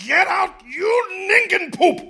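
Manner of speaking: high angry yell; furious shout. Acted. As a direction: throw him out at the top of your voice. Boss throws out salesman.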